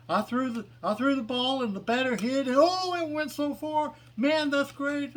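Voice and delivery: put-on high, bright, gleeful oh boys; happy sea creature. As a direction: squeal with delight like a happy monster. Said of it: "He's really happy".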